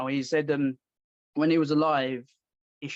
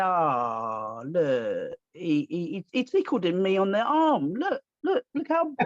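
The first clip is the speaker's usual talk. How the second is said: mock a fighter who complains about a tickle; hammed-up tattletale voice; mock crybaby. Mock whining victim.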